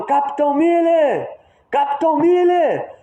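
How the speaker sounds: high-pitched urgent call to the captain; mock battlefield shout. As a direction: call out like a sergeant under fire. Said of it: "ele tá gritando".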